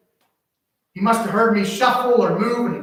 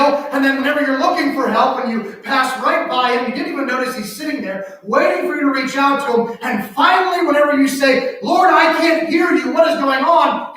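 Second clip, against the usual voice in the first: full-force preaching; 6 semitones higher, 5 dB louder than usual. Acted. Pastor preaching hard to the congregation. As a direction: drive the point home to the congregation.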